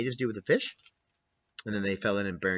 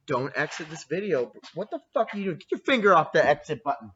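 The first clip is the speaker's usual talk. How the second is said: ordinary talk, nothing marked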